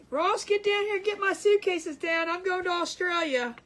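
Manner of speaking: loud, excited call to her husband. Retold or imitated. Announcing a trip to her husband, loud and excited call. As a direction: shout upstairs for the suitcases, thrilled. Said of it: "So I'm saying".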